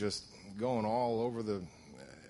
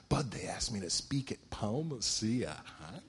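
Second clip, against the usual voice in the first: breathy hushed whisper, half to himself; whispered quote. Retold or imitated. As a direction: hush it out like sharing a secret with the sponsor. Excited news told to sponsor.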